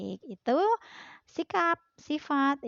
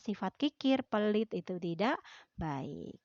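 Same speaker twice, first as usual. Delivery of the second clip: normal talking voice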